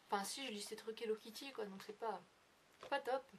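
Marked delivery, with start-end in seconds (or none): none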